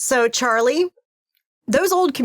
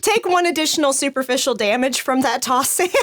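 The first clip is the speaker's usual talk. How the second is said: laughing; 7 semitones higher than usual. Real, not acted. Game master laughing while giving damage.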